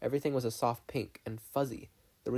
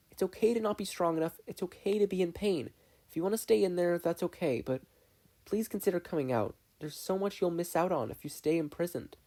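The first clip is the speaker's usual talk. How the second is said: put-on gentle girl voice; 7 semitones higher than usual. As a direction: reassure him like a caring sister. Mabel comforts her brother.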